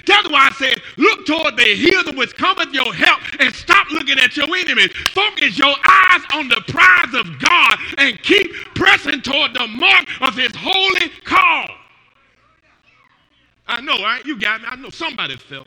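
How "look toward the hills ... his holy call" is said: louder full-force sermon delivery; preaching. Acted. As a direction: drive it home to the congregation.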